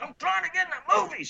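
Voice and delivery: high, fast shouted retort; arguing. Retold or imitated. Climber arguing back from the fence, high defiant shout. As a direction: yell back in high-pitched, hurried defiance. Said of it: "I'm arguing with her".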